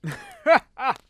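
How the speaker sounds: a short knowing chuckle, high and loud; hearty mock-innocent chuckle; amused laugh. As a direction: laugh it off like a sly old host. Amused laugh at spying question.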